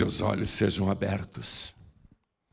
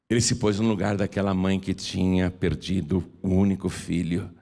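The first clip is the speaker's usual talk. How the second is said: normal talking voice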